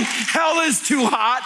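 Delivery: slow, high shouted line; preached shout. Acted. Preacher warning the crowd, slow high shout. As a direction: hammer it home slowly.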